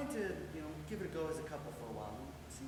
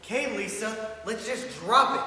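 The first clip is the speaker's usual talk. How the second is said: loud, higher, fed-up outburst; shouted demand to end the argument; exasperated shout; 5 semitones higher, 16 dB louder, slower than usual. Acted. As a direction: cut your partner off at full volume. Quarrel, cuts partner off loudly.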